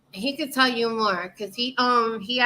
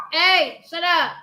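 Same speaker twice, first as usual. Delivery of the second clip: raised, louder telling-off; real scolding call; 5 semitones higher, 5 dB louder, slower than usual. Real, not acted. Host breaks off to hush her dogs, scolding.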